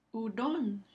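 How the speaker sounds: "Hurón" emphasized on the last syllable; stress on RÓN. Shown as a demonstration. Teacher repeats for listening students, final stress on RÓN in "Hurón". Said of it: "ron, que recibe el acento prosódico".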